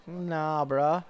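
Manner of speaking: casual slangy dismissal; ironic zoomer. Pretend. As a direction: brush it off like a teen. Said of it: "they're like".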